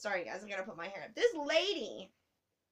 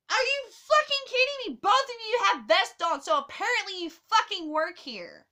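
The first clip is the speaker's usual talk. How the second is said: much higher, loud indignant outburst; furious rant; 8 semitones higher, 8 dB louder than usual. Real, not acted. Telling viewers about rude station staff, furious rant. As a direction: vent full fury at the rude staff.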